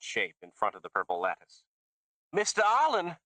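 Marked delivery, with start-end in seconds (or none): 2.3-3.2 high called greeting; woman crying out. A narrator's reading. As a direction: call out high and eager. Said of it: "a woman cried".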